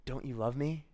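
soft, unhurried, tender question; gentle Jesus. Acted. Risen Jesus asking Peter for his love, gently. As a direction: speak quietly and let each phrase rest. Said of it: "and Jesus asks him".

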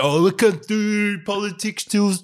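slow, high whiny mock of the PM; mocking the politician. Mocking the PM's excuse to his mate, slow and high. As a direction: drag it out like a lazy politician making excuses. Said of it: "And the guy's like".

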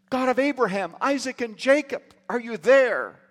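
high desperate cry out. Retold or imitated. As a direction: call out to God in despair. Desperate man crying to God.

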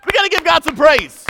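high, loud rallying shout; full-force preacher's cry; shouted praise. Real, not acted. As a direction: belt it out to the whole congregation. Repeats rallying call, louder.

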